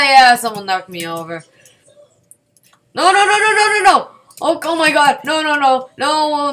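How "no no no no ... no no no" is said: high, loud frantic pleading; real gamer panic, higher and louder; panicked no's. Real, not acted. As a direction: cry out no as it goes wrong. About to die in game.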